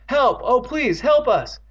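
high, loud desperate plea; screaming for help. A narrator's reading. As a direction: cry out desperately for rescue. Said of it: "hoarse from screaming".

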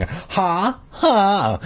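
high, fake sarcastic laugh. A narrator's reading. Beth to the boys over their worm story, sarcastic. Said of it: "Beth said sarcastically".

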